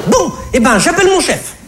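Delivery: voix de caissière très aiguë, exaspérée; vendeuse excédée. Acted. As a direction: rembarrer le client en glapissant. Vendeuse qui menace d'appeler son chef. (vendeuse glapissante et excédée)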